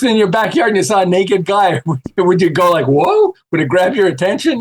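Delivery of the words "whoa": mock startled whoa. Imagined reaction to naked guy.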